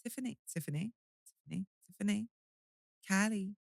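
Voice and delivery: small, soft American rehearsal; American accent. Acted. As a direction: try the name softly in an American accent. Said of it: "best American accent".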